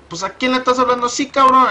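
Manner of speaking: high-pitched Spanish-speaking dad; imitating a puzzled, scolding father; suspicious dad. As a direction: question your son in a high, put-on voice. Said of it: "My dad, he, he was looking at me like".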